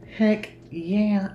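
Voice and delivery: high, bright burst of joy; thrilled. Retold or imitated. Fan hearing about the new protagonist, ecstatic cheer. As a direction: cheer it like great news just dropped. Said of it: "I'm like".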